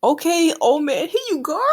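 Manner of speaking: excited squeal. Real, not acted. Reacting to Hyogoro's send-off.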